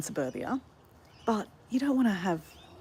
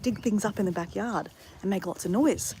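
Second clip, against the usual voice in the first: normal talking voice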